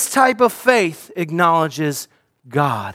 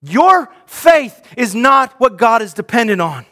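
preaching shout; 5 semitones higher, 4 dB louder than usual. Real, not acted. Preaching climax with music.

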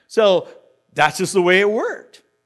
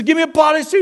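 higher and louder, pressing the demand; insistent demand; 8 semitones higher, 5 dB louder, much faster than usual. Retold or imitated. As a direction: repeat it, now pushing hard. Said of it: "He says".

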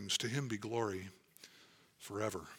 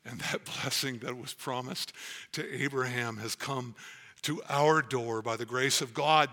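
ordinary delivery

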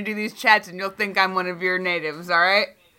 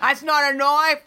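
slow, higher Australian drawl imitating Crocodile Dundee; mock Aussie bushman, a bit higher and slower; Crocodile Dundee Australian accent; 5 semitones higher than usual. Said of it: "and Crocodile Dundee".

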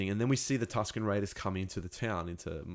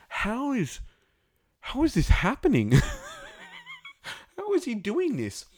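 high, loud, exasperated disbelief; much higher, louder, laughing at how absurd it is; incredulous disbelief; 9 dB louder than usual. Retold or imitated. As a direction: squeak out the question in amazed outrage. Incredulous at dragon on mountain.

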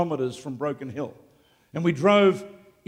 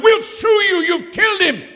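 high, loud angry yell; the family shrieking threats; family yelling at the preacher; shouting family; 13 semitones higher, 6 dB louder than usual. Retold or imitated. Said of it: "the family were going".

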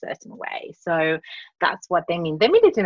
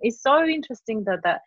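ordinary talk

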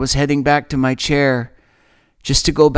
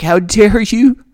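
how dare you, flung back at the cruel neighbors; a raised, indignant cry of anger; furious retort; 9 semitones higher, 5 dB louder than usual. Retold or imitated. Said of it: "I was like".

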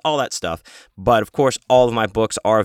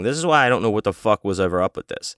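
normal talking voice

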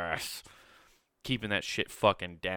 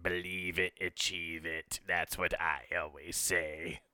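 low, soft evil emperor voice; a sinister old ruler musing; Emperor Palpatine; 3 semitones lower, 6 dB quieter, slower than usual. Pretend. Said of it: "Emperor Palpatine trying to do words of affirmation".

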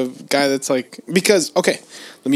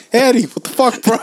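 mock callout; 8 semitones higher, faster than usual. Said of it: "you'd be like".

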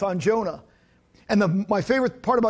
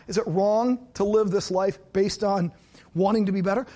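ordinary talk, nothing marked

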